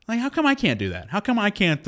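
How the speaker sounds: high, frustrated complaint; exasperated whine. Real, not acted. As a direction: plead it, baffled and fed up.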